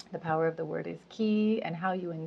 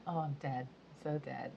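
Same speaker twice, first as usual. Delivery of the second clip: imitating niece; 3 semitones lower, 11 dB quieter than usual. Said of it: "heard my little niece saying".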